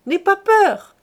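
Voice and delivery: "N'aie pas peur" called out loud and high; cried out. Acted. Aunt calling to the girl that the dog is harmless, loud. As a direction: call out to reassure the child over the dog. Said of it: "cria madame Chantot".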